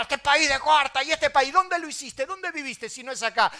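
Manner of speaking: high, heated complaint and retort; acted indignant rant. Preacher mocking Argentines who run down their country, acted indignation. Acts out complainers and rebukes them.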